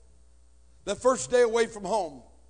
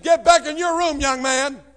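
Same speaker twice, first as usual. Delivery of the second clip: higher and much louder barked order; stepfather's yell; 7 semitones higher, 11 dB louder than usual. Retold or imitated. Stepfather orders the boy to his room, yelling. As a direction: command him back to his room.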